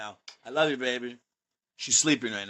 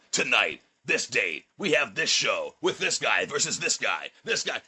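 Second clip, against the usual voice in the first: lower, slow announcer voiceover; radio voice; 5 semitones lower than usual. Shown as a demonstration. Wrestler voicing a match graphic promo, low radio announcer. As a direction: read it like a radio promo spot. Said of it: "I switch into my radio voice".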